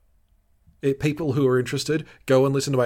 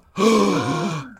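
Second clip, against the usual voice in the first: loud, hammed-up gasp; mock shocked gasp; 7 semitones higher, 5 dB louder than usual. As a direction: gasp dramatically for a retold shock. Acting out the shocked realization.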